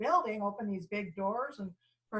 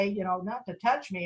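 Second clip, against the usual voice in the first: ordinary delivery, nothing marked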